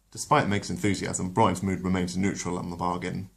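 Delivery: ordinary delivery, nothing marked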